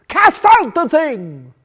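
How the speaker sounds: high, loud furious tirade, slowing at the end; loud, high outraged prophet's denunciation; angered prophet. Acted. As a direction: thunder it like an angry prophet. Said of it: "hurled the words like an angered prophet".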